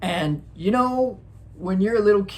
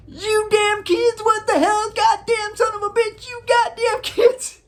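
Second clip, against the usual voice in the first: mock angry driver; 3 dB louder than usual. Driver cursing the kids who ran out, hammed-up high angry voice. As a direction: play the cursing driver who nearly hit you, way up high. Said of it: "He was so pissed".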